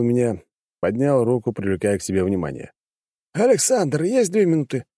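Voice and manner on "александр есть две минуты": put-on old man voice. A narrator's reading. Pushkin voiced in story.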